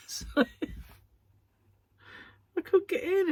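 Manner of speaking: ordinary talk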